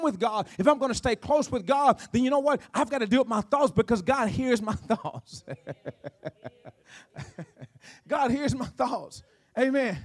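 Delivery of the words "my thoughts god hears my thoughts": slow words broken by real chuckles; laughing. Real, not acted. Preacher admits God hears his thoughts, real laughing. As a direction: chuckle through it, taking your time. Amused at own point, laughs.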